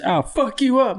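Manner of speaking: high hammed-up dad threat; mock dad threat. Son imitates the father threatening him over a Pop-Tart, high mock voice. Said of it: "and he just".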